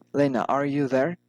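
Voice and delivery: "there" rising at the end; rising intonation. Shown as a demonstration. Teacher models direct address, rising intonation on "there". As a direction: lift "there" into a question. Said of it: "rising intonation".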